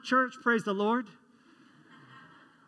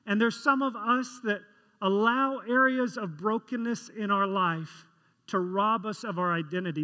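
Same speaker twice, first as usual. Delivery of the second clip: ordinary delivery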